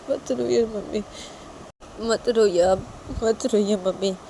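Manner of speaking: mildly tearful, pleading son; understated weepy coaxing; breaking into tears. Acted. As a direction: console her while starting to cry yourself. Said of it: "बोलते बोलते वो लड़का भी रो देता है".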